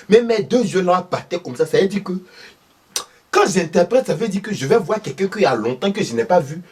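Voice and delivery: voix aiguë de vieille dame, animée; vieille maman ivoirienne. Acted. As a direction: raconte comme une belle-mère du village. Thérèse explique ses yeux qui battent. (Madame Thérèse, voix aiguë de vieille maman)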